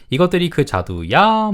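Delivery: "이것들이 그 자두야" asked high, with "야" stretched; high-pitched question, lingering on the end of "자두야"; questioning tune. Shown as a demonstration. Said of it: "물어보는 겁니다".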